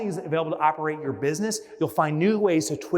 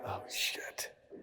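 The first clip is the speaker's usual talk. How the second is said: hushed "oh no" under the breath; whispered dismay; 10 semitones lower, 16 dB quieter, slower than usual. Retold or imitated. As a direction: whisper it like you just remembered. Realizing toothpaste forgotten.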